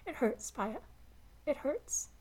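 high, strained with pain; raw pained voice. A narrator's reading. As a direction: push the hurt through each word. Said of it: "Hero's raw, steel-edged voice".

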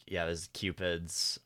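low, soft, drawn-out disdain. Retold or imitated. Retelling a character's talk about the Cupids, disdainful. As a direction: say it with quiet contempt. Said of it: "like, disdain in his voice".

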